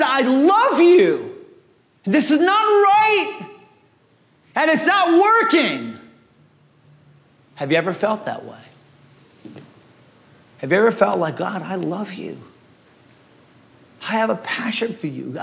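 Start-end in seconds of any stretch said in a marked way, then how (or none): 0.0-1.3 slow earnest love. Acted. Elijah cries to God. 2.0-6.0 much higher, shouted, slow protest; anguished shout. Acted. Elijah's anguished outcry. 11.4-15.4 much softer, hushed confession of love to God; soft prayer. Acted. Congregation voicing tender prayer.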